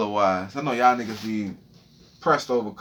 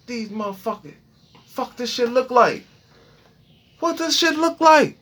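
high, frantic squeal of panic; shrieking in alarm at what he sees; panicked freakout; 11 semitones higher than usual. Retold or imitated. A man staring at a rash in panic, high frantic squeal.

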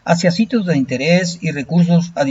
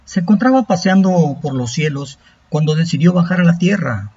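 epic fantasy narrator; 3 semitones higher than usual. A narrator's reading. Narrates an Aztec legend.

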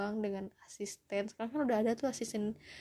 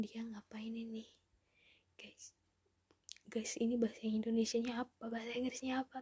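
panicked whisper to friends; hush-voiced plea for help; confused whisper; 4 dB quieter than usual. Acts confused self watching English film. (bisikan bingung minta tolong)

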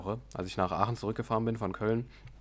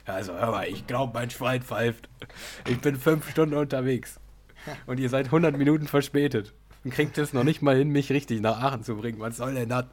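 angry rant; 3 semitones higher than usual. Retold or imitated. Angry passenger retold.

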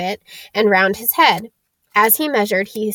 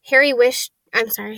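ordinary delivery, nothing marked

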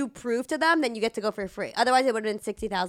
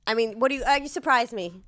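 ordinary delivery

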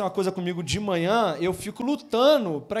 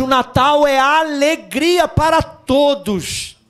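full-force preaching; 8 dB louder, slower than usual. Acted. Pastor proclaims joy to the congregation, loud preaching. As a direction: preach it out to the whole church.

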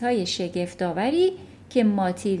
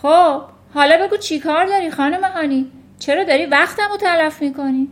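loud, raised-pitch impatience; impatient headmistress; 7 semitones higher, 8 dB louder than usual. A narrator's reading. Headmistress to teacher wasting her time, impatient. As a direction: demand an answer, louder and higher.

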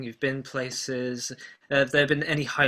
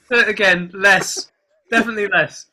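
laughing; 7 semitones higher, 9 dB louder than usual. Real, not acted. Laughing at the announcer voice.

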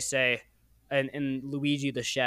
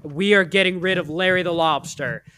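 louder, higher, slowed-down mock resolve; theatrical fed-up announcement; emphatic declaration; 5 semitones higher, 6 dB louder than usual. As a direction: declare it like a vow. They slam the table, declaring.

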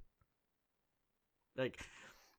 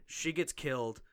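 ordinary delivery

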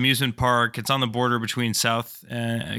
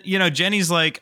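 ordinary delivery

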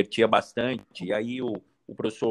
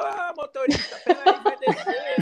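chamando o motorista; 5 dB louder than usual. Retold or imitated. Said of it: "Eu falei".

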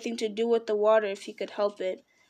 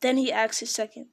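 ordinary delivery, nothing marked

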